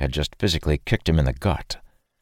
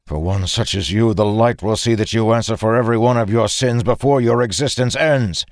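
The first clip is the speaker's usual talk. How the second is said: angry judgement; 6 semitones higher, 4 dB louder than usual. A narrator's reading. Angry judgement mid-fight.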